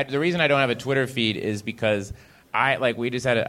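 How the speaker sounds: usual speaking voice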